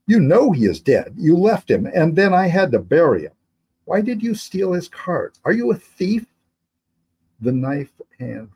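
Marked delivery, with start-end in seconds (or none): 0.1-3.3 put-on higher, louder boy's voice, accusing; a grieving boy blurting out the truth; upset boy. Boy accusing his captor. 3.8-6.2 high-pitched challenging questions; a much higher voiced interrogation; questioning captor.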